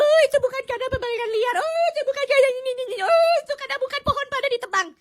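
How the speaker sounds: high, sing-song mock excuse voice; mocking officials. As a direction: whine the excuses like a dodging bureaucrat. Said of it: "Mau pada bilang".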